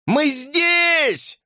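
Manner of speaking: very high, stretched-out cry; yelling. A narrator's reading. Hamster hailing the searchers, yelling high. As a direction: holler to be found, high and long. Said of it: "завопил хомяк".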